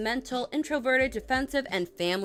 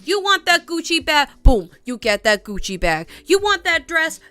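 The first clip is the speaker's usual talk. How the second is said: higher and louder, punchy pep talk; hype pep talk; 5 semitones higher, 7 dB louder than usual. Acted. Hyping viewers up to buy themselves treats, loud and punchy. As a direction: pump the viewers up, each line a cheer.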